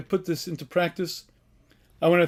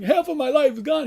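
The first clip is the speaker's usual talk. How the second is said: higher, whining lament; mock crying; 7 semitones higher than usual. Rabbi retelling the sage's cry to God, mock whine. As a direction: whimper it like a man losing years. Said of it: "He starts crying".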